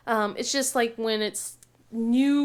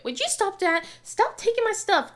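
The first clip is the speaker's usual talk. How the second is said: put-on high whiny kid complaint; squabbling kid; 9 semitones higher, 3 dB louder than usual. Mom imitating her kids bickering, high whiny complaint. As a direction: whine like a sibling fighting. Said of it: "five minutes into it".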